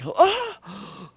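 a coworker amazed to hear a normal voice; surprised cashier. Retold or imitated. Cashier amazed her coworker can talk, high surprised squeal. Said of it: "and she was like".

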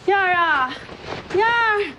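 urgent high-pitched hail; calling out. Acted. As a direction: call out to someone out of sight. Mother calls after the girl.

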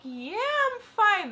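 high, strained "fine" that fools nobody; squeaky denial. Retold or imitated. Answering worried friends outside the bathroom, high and unconvincing. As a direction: push it up high to cover being drunk. Drunk, friends ask if she's okay.

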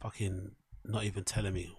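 quiet annoyed mutter; quiet grumble. Real, not acted. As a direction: grumble to yourself, barely audible.

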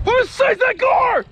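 high, loud amazed cry; astonished shout. Real, not acted. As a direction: shout in disbelief at the size. Huge gar spotted.

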